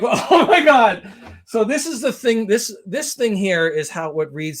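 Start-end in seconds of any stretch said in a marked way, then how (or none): 0.0-3.3 laughing disbelief. Retold or imitated. Laughing quote.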